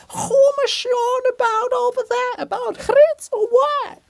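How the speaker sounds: very high, far-off call; distant giant voice. Acted. Volodymyr's monument calling from afar, very high distant voice.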